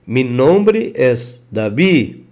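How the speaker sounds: Davi said dabi, v as b; Spanish Davi with B. Shown as a demonstration. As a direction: soften the v of Davi to a b. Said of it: "o som oficial é B".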